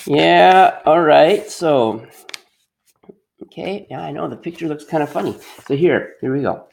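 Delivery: bright, encouraging classroom teacher voice; teacher addressing class. Acted. Teacher talking to an imagined grade three class on the carpet, bright and encouraging. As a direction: coax the kids along like a grade three teacher.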